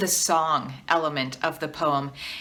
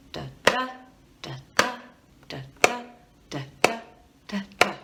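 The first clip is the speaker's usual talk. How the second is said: "da, da" with the second "da" stronger each time; iambic da-da beat; 4 dB quieter, much faster than usual. Shown as a demonstration. Teacher showing students the beat, stressing each second da. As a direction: tap out the da-DA beat evenly. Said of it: "Can you try that with me".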